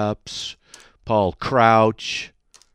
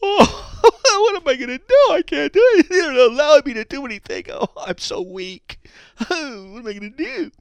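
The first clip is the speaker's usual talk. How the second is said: high, loud, whimpering mock helpless voice with laughs; mocking whiny God; 3 dB louder than usual. Host mocking a preacher's weak God, high whiny voice. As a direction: whine like a feeble, powerless God, laughing. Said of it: "I can see God up there in heaven".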